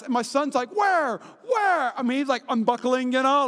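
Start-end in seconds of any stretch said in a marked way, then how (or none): 0.7-1.9 high, urgent mock cries; imitated frantic son. Son unbuckling to find the coyote, mock frantic. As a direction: pitch it up like a kid desperate to see.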